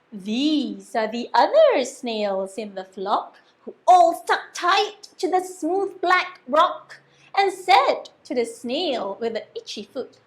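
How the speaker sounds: ordinary delivery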